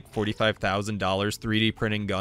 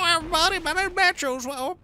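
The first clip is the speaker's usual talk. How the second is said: much higher, silly character voice; high cartoon voice; 3 dB louder, faster than usual. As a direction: squeak it out like a cartoon. Voicing his game character.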